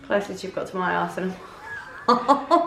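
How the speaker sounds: teasing line through laughter, slowly; laughing joke. Real, not acted. Wife teasing her husband before the injection, laughing. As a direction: laugh while teasing him.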